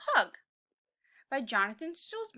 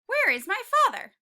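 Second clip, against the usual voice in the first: higher, louder, fast call; 8 semitones higher, 10 dB louder, faster than usual. Acted. Tiny T-Rex calling out for his father. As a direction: call out searching for dad.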